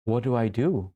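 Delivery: mock gasp, then a stunned question; breathless freeze. A man in a challenging situation who can't breathe, frozen. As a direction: act out freezing with a caught breath. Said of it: "you couldn't breathe".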